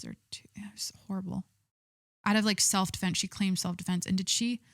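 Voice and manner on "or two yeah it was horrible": whispering. Real, not acted. Hushed remark on a killing.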